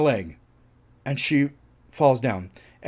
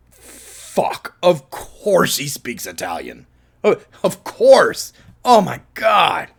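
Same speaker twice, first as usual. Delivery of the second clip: exasperated realization; 2 semitones higher, slower than usual. Retold or imitated. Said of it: "I was just like".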